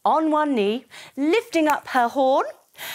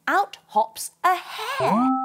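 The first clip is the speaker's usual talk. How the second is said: surprise reveal; 6 semitones higher, slower than usual. A narrator's reading. Sudden appearance.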